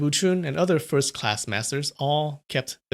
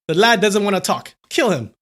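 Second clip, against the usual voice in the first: raised, commanding voice; ordering; 6 semitones higher, 5 dB louder than usual. A narrator's reading. Leader tells swordsman to execute the boy, commanding. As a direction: give the order firmly and high. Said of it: "Lu Bai ordered".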